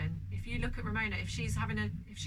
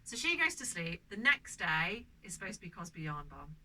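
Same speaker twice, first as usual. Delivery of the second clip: ordinary talk, nothing marked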